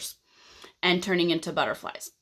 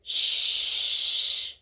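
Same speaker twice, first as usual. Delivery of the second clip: long shushing. Acted. Caterpillars in chrysalises shush him, annoyed. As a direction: hush them with one long hiss. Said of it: "all together they say".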